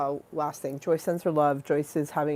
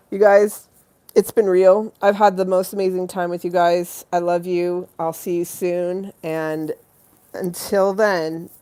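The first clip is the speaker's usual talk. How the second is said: loud farewell; 10 dB louder than usual. Real, not acted.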